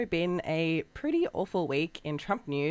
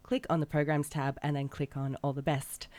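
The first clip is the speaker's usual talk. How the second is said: ordinary delivery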